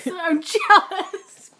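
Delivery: drawn out through a laugh. Real, not acted. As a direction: laugh while moaning it. Laughing envy.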